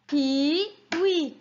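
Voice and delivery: KIWI drawn out as KI, pause, WI; split into syllables. Shown as a demonstration. Teacher has children count along, KIWI broken into two beats. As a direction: say KIWI syllable by syllable, each one held. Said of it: "combien de syllabes dans le mot".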